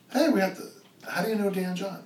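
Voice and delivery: imitating excited fanboy. Guy at a bar asks daughter about her famous dad, mock fanboy excitement. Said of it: "he went fanboy on him".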